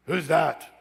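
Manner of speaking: lightly voiced gruff challenge from a hard-nosed dad; hard-nosed father. Father walking in on son's meeting, hard-nosed. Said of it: "hard-nosed character".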